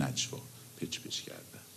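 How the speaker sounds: dropping to a whisper to show a secret murmur. Shown as a demonstration. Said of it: "در گوشی حرف زدن".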